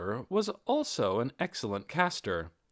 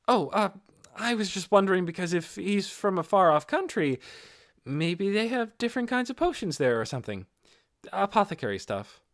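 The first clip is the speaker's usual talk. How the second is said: put-on higher, lighter young man's voice, curious; higher-pitched Inferia, asking with interest; Inferia's voice; 8 semitones higher, 4 dB louder than usual. A narrator's reading. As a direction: voice the eager young apothecary, pitched up. Inferia covering his curiosity.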